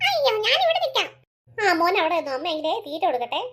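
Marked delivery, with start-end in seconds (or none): 0.1-1.1 put-on high, small child's voice; little boy voice. Young son answering his mother, high childlike voice. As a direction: play the young son, eager and sweet.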